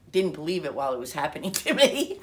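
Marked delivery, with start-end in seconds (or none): 1.6-2.2 words rising loudly into a real laugh; breaking into laughter. Real, not acted. Recalling disbelief while it happened to her, laughing. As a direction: let laughter take over the last words.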